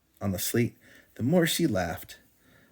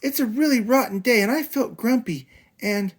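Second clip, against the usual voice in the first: whiny protest; 14 semitones higher, 5 dB louder than usual. Acted. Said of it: "protested Sister".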